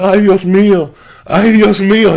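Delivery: loud, high wail of pain; uncle crying out. Retold or imitated. Uncle fallen off the ladder, loud high pained wail. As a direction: cry out Ay Dios mío in pain.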